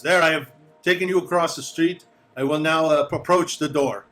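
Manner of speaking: lightly voiced formal guide. Guide to guests after crossing, formal announcing. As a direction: announce each step to your charges.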